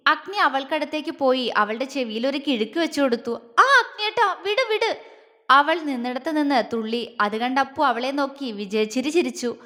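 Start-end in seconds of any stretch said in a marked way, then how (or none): 3.3-5.0 high-pitched pleading yelp; pained yelp. Acted. Ponnu yelps as Agni pinches her ear. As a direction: cry out to be let go.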